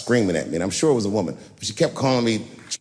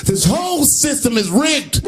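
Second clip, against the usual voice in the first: screaming Trump; 13 semitones higher, 3 dB louder, slower than usual. Retold or imitated. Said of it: "screaming at the top of his lungs".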